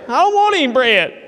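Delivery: high, loud whiny refusal; petulant grown man's whine; whining Ahab. Acted. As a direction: pout like a spoiled king. Ahab sulks, refuses dinner.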